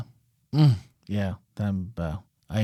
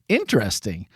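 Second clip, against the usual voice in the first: ordinary talk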